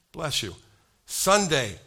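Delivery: usual speaking voice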